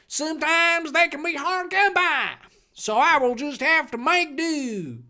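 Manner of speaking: high, syrupy church-lady voice; mock author voice. Author addressing readers in a note, high sugary mock voice. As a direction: play the pious fanfic author, sweetly.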